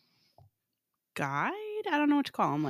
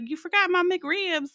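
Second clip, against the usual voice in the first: imitating Southern lady; 9 semitones higher, slower than usual. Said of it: "she was like".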